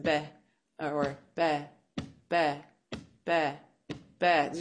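"be" as a short, detached beat; single syllable "be", spaced like metronome clicks; isolated syllable on a beat. Shown as a demonstration. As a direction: tap out "be" with even pauses. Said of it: "taking apart the syllables".

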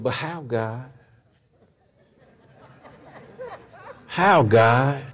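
a hushed, unsure Moses pleading with God; doubtful Moses. Retold or imitated. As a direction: let Moses ask it small and lost. Moses questions God in retold story.

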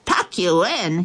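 ordinary delivery, nothing marked

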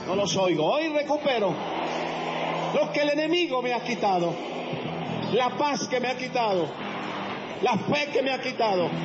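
proclamación muy fuerte y aguda; predicación fervorosa. Acted. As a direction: arengar a la congregación a gritos. Predica a pleno pulmón. (predicación a todo pulmón)

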